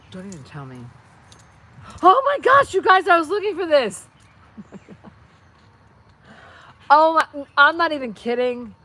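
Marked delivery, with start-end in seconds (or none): 2.0-4.1 much higher, louder, squealing with joy; real excited squeal. Real, not acted. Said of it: "Oh my God, I'm so excited". 6.9-8.7 excited. Real, not acted. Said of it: "I'm so excited".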